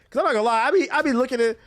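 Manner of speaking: animated, exasperated rant; heated rant. Real, not acted. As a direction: wind up about cheat day culture. Ranting about cheat day posts.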